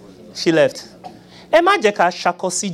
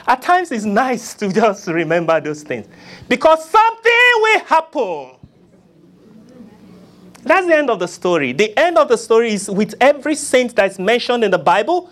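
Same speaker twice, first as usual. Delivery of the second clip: normal talking voice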